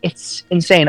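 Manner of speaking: high-pitched, exasperated disbelief; incredulous. Real, not acted. Disbelief at the political news.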